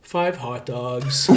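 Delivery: slow and soft, every word dragged out; sheepish kid. Retold or imitated. Kid explaining his upset stomach to the coach, slow and sheepish. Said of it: "He's like".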